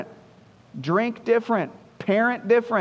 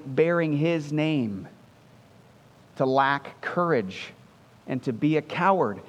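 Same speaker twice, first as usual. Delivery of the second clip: ordinary delivery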